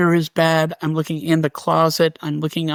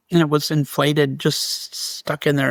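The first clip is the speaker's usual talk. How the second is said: ordinary talk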